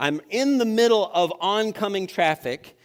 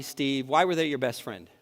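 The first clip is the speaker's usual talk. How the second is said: ordinary delivery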